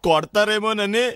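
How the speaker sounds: pre-emptive whimper, last syllable held; tearful. Acted. Son fearing a beating from his father, tearful. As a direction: whine it out as if about to cry. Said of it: "మరి ఎందుకురా ఏడుస్తున్నావు".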